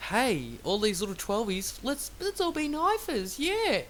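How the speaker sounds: mocking hyped kid. Vlogger mocking little twelvies who want to be knifers, in a hyped kid voice. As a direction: sneer at the young fans with a silly kid voice. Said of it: "gonna make".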